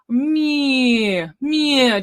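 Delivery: "me" sung out long on ee; vowel variation. Shown as a demonstration. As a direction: sustain each "me" on a sliding ee. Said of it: "Just play around with the vowel".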